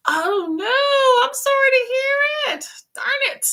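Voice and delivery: high, sympathetic gasp of dismay; dismayed sympathy. Real, not acted. Sick viewer lost her voice.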